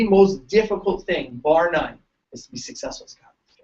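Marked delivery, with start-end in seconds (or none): 2.3-3.6 hushed confiding whisper. Real, not acted. Speaker confides to chiropractic students in a whisper. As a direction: lean in and whisper it.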